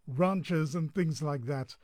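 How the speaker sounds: ordinary delivery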